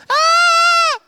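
sudden very high, very loud scream; mock scream. Friend in the fire is still screaming, hammed-up scream. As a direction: let out a piercing mock shriek mid-sentence. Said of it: "You can stop screaming".

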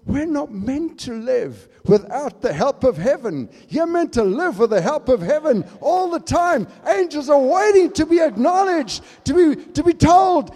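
loud, high preaching; fervent sermon delivery; preaching at full force. Acted. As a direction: drive it home to the congregation.

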